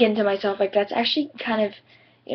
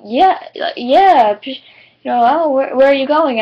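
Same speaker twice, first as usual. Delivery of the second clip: loud, higher, eager burst of questions; excited retold kid; 5 semitones higher, 9 dB louder than usual. Kid at the orthodontist eagerly asking her school, loud and excited. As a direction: kid keenly asking where she goes.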